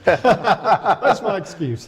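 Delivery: laughing through the words. Real, not acted. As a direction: say it while laughing. Laughs at own poor pronunciation.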